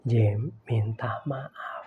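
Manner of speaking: low, slow whisper; whispered voice of God. Retold or imitated. God repeating to Jim in a whisper, slow insistence. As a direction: breathe it out, gentle and firm. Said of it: "Sekali lagi, Tuhan".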